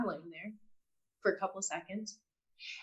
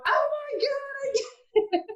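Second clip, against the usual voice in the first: high, tearful burst of understanding; much higher, crying with joy at the revelation; overwhelmed realization; slower than usual. Retold or imitated. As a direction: let it break open in a high, teary rush. Overwhelmed realization talking to father.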